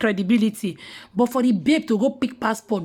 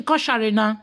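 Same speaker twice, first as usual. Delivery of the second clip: ordinary talk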